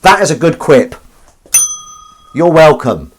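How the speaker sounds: loud, high, forceful self-praise; pushed hard, pitch and volume up; aggressive. Real, not acted. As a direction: throw it out combatively at the audience. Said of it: "why I'm getting so aggressive".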